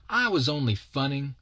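put-on high-pitched, sulky boy; sullen kid. A narrator's reading. Scolded kid excuses himself to his captor, sullen high voice. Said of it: "says he sullenly".

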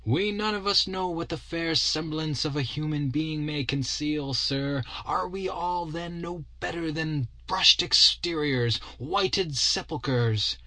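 slow, solemn preachy shopkeeper; a sly keeper moralizing slowly; shopman voice. A narrator's reading.

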